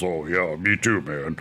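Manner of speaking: dropped low into a lazy dude voice; mock deep voice. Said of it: "someone with a deep voice".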